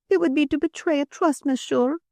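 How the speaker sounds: high, delicate French madame; pleading Frenchwoman. A narrator's reading. Madame refusing to betray a trust, pleading. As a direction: plead primly in a high-pitched voice.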